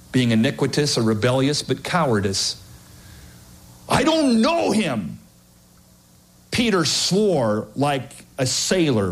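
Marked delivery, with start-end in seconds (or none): 3.9-5.2 high, slow, anxious denial; Peter's denial. Acted. Peter denying he knows Jesus, high and scared. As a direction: deny it in a panic.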